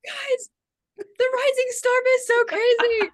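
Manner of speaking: high-pitched mock fangirl squeal of words; imitated giddy excitement, very high; excited fan. Acting out friends' early excitement.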